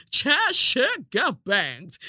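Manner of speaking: slow drawn out British accent imitation; mock British accent. Chair quoting a British lady, mock British accent. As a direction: drawl it like a Brit, slow. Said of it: "in your British accent".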